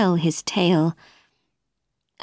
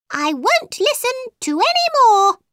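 high, indignant squeak of temper; very angry mouse. A narrator's reading. Mouse fed up with Alice, high angry cry. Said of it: "the mouse was very angry now".

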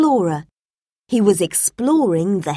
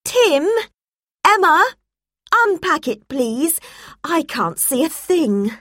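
Mum asking; 8 semitones higher than usual. A narrator's reading. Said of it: "Mum said".

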